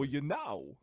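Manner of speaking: odd cadence with "know" split in two; Christopher Walken. Acted. Repeating the actor impression for the co-host, stretched know. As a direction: swoop through a two-syllable KNOW. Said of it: "a one syllable word and turn it into two".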